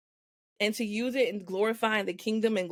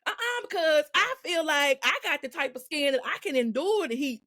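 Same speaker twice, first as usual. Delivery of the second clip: put-on stubborn child voice; 6 semitones higher than usual. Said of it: "we just like".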